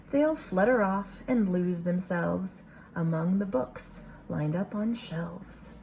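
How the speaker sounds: usual speaking voice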